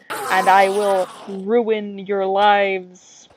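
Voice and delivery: mock villain teacher, slow and grand at the end; cackle, then threaten slowly like a storybook villain; hammed-up evil teacher laughing manically; manic villain laugh. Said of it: "she just laughs manically".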